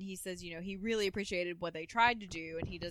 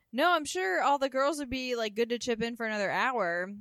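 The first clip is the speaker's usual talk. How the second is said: eager plea; 4 semitones higher, 7 dB louder than usual. Retold or imitated. Bernice begging stripper stay.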